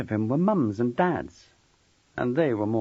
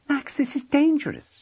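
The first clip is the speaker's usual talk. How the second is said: very high, urgent woman's voice; alarmed warning; 4 dB louder than usual. A narrator's reading. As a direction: warn him anxiously, pitched right up. Frightened woman warns him.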